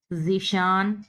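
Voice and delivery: ordinary talk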